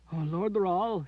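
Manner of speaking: high, tearful sob; high sobbing cry. A narrator's reading. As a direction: sob his name. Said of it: "she sobbed".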